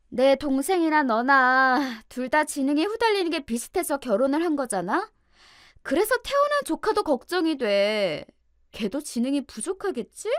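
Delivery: high-pitched, theatrical contemptuous taunting; mock concern dripping with scorn, ending on a gasp; mean belittling. A narrator's reading. Sister-in-law belittling her brother's wife on video, mean taunting. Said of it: "악마 같은 표정을 마음껏 지으며 저를 짓밟았어요".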